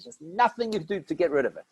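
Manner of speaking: ordinary talk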